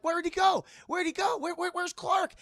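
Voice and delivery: high, loud, frantic calling; frantic kids. Retold or imitated. Kids searching after Clark dives back, acted panicked shouts.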